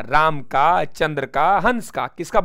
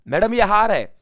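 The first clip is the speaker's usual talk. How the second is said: emphatic drill; 5 semitones higher, 5 dB louder than usual. Shown as a demonstration. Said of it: "mnemonic drill, first take".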